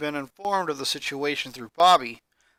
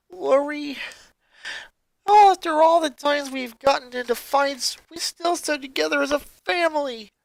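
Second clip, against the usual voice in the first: higher character voice, a touch tearful; 11 semitones higher, 5 dB louder than usual. Acted. Musician sister confesses their guilt, tearful. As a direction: speak through rising tears. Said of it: "Tears began rolling down the musician's face".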